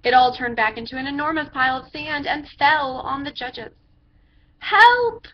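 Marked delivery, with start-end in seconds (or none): 4.6-5.3 high, loud cry for help; yelled help. A narrator's reading. Judges buried in sand yelling for help. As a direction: scream for rescue from under the sand. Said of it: "yelled the judges".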